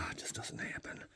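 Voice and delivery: breathy whispered repeat of "It just doesn't happen.". Acted. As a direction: drop it to a bare whisper, resigned. Repeats the line, then whispers it.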